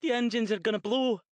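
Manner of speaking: high, loud, hammed-up Scottish yell; terrible Scottish accent. Shara playing the engineer to Jason's captain, terrible Scottish yell. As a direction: yell back as a bad Scottish engineer, quickly. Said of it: "yelled back in a terrible Scottish accent".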